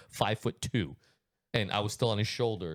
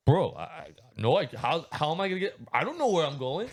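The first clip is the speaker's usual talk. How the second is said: mock-flustered, higher-pitched stammering; flustered protest; 6 semitones higher than usual. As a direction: act out a lost, helpless boater. Acting out dad's lost objection.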